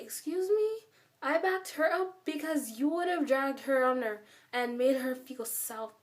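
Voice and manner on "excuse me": much higher, incredulous "Excuse me?"; mock offended disbelief; indignant surprise. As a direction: retell your shocked comeback, pitched up. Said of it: "And I'm just like".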